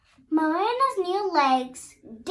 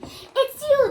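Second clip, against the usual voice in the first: high, loud burst of recognition; excited prince; joyful cry; 10 semitones higher, 7 dB louder than usual. A narrator's reading. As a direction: cry it out, overjoyed to find her. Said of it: "the prince cried".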